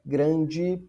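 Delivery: normal talking voice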